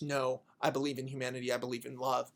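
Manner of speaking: low, soft heroic declaration; acted calm resolve; quiet conviction. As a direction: state your faith gently. Heroine's sincere declaration retold.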